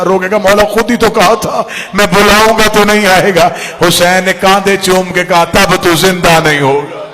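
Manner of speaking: loud, wailing majlis narration; full-force preaching. Acted. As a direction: call the scene out to the weeping crowd. (ज़ोरदार रोती मजलिसी आवाज़)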